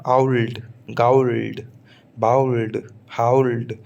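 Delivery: आउल्ड with an ow glide, owld; teacher models British old words. Shown as a demonstration. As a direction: round the vowel into ow in गाउल्ड, बाउल्ड. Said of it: "फिर है औ".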